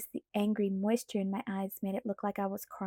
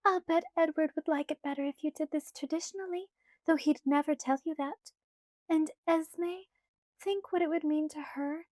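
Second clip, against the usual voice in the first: put-on light, higher girlish pleading voice; Alice's voice; 6 semitones higher than usual. A narrator's reading. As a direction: voice Alice higher, coaxing Bella. Alice persuading.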